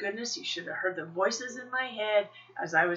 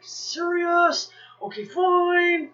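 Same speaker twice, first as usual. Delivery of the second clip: high, loud, whiny mock exasperation; hammed-up reluctant "fine", pitched up; grudging compliance; 11 semitones higher, 6 dB louder, much slower than usual. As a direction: give in with a drawn-out protest. Grudging reply to inner voice.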